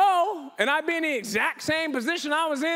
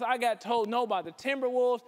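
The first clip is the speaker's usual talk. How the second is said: ordinary talk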